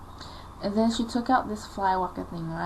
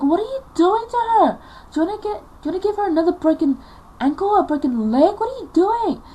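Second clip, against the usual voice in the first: loud, high-pitched angry protest; outraged; 11 semitones higher, 8 dB louder than usual. Retold or imitated. Dad confronting mum over hitting her, outraged. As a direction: shout in protest, rushing the words.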